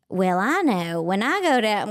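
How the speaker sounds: imitating a thick Arkansas twang; Southern twang. Daughter back from visiting Arkansas cousins, imitated Southern twang. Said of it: "she was talking like that".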